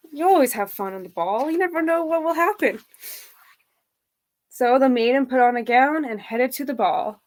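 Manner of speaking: ordinary delivery, nothing marked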